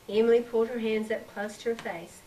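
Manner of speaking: ordinary delivery, nothing marked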